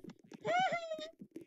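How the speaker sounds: very high, squealy laugh; high giggle. Real, not acted. Troll gloating over past pranks, high giggle. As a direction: let out a high-pitched giggle.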